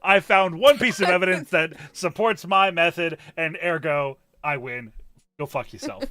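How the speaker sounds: loud mock gloating voice of Hera; imitating Hera. Host mimicking her friend's gloating, a hint of mock triumph. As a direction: play the smug winner.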